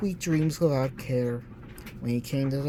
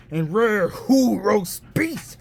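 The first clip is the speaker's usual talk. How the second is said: slow, higher, sneering roast beef; disgusted; 5 semitones higher, 4 dB louder, slower than usual. A narrator's reading. Grinch disgusted by Who roast beef, slow sneer. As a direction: drag it out with distaste.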